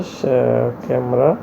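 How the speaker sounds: ordinary delivery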